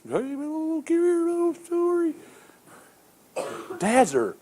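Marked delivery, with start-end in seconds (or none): 0.0-2.1 much higher, softer mock mother voice; imitating mom. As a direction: tease how a mom coos a little story. Mimicking mom's soft talk.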